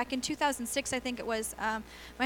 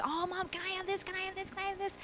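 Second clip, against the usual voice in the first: put-on higher, pleading little girl asking over and over; begging daughter; 7 semitones higher, 6 dB quieter than usual. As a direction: imitate a child nagging mom for toys.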